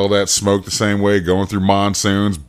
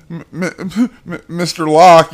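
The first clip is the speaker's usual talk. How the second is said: put-on higher, slow, stammering child; lightly voiced hesitant child; mock timid kid; 8 semitones higher, 5 dB louder, slower than usual. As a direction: stutter it out like a nervous kid.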